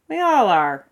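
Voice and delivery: muffled, as if under a hat. A narrator's reading. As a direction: speak from under a hat brim. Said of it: "Athos' muffled voice came from beneath his hat".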